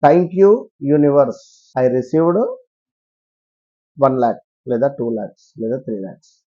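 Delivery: slow affirmation. Shown as a demonstration. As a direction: recite it like a grateful manifestation mantra. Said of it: "అది ఏమిటయ్యా అంటే".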